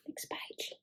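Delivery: whispered page cue. Real, not acted. Page-turn whisper to child.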